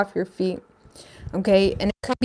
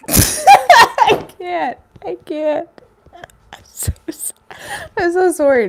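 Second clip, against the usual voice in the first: high, squeaky, laughing apology; real giggle fit; helpless laughter; 9 semitones higher, 7 dB louder, slower than usual. Real, not acted. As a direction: try to apologise but laughter wins.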